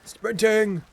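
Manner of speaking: loud, high one-word shout; excited callout. Real, not acted. Streamer announcing a move in the game, shouted. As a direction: call it out across the room. Calling out during play.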